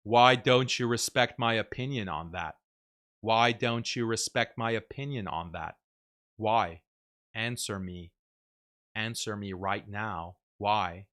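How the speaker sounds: low, quiet, menacing serial killer voice. Retold or imitated. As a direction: ask it softly like a killer in the dark. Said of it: "It sounds like a serial killer".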